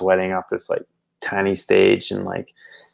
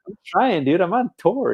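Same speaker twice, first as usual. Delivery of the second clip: much higher whiny plea; exasperated self; 11 semitones higher than usual. Retold or imitated. Singer pleading with the drunk fan about touring. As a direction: whine it defensively.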